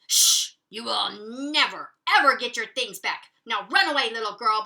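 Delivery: loud, menacing dog threat; growling threat. Acted. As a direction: snarl it to scare her off. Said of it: "Mister Dog growled".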